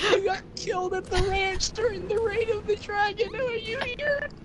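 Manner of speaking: crying high and strained; high weeping voice. Acted. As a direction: sob it out in a high voice. Orc warlord weeping for father.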